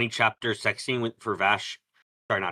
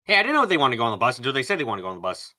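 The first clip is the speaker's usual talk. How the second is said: fast; 2 semitones higher, 4 dB louder, much faster than usual. Real, not acted. Defensive rapid retort.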